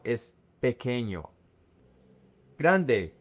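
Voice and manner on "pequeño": "pequeño" as peh-KEH-nyo, falling; plain K with no W in "pequeño"; correct silent U. Shown as a demonstration. Teacher corrects the mistakes, "pequeño" with KEH. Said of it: "the Q U E, is K".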